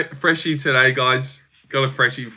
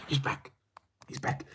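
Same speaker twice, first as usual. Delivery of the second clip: each repeat softer until barely voiced; fading echo; 16 dB quieter than usual. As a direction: let it fade out like an echo.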